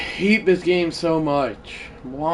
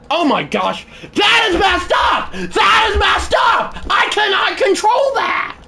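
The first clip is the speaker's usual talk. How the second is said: real protesting yell, pitched way up; furious; 12 semitones higher than usual. Real, not acted. Gamer raging that it's out of his control, furious. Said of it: "I am furious right now".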